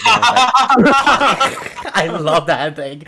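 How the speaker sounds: slow, laughing through the words; real laughter while telling. Real, not acted. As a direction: giggle helplessly at the video. Cracking up at the video.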